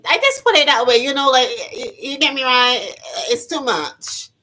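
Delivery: high, loud, giggly rant; laughing high. Real, not acted. Vlogger cracking herself up over the long word, loud and high. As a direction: cackle through it, wound up high.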